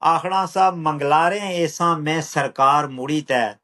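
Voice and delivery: rhythmic, measured verse with a returning refrain; poetry recital. Acted. Poet reciting a poem on migration, measured rhythmic recital. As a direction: recite it unhurried, falling on each refrain.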